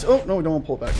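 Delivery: ordinary talk, nothing marked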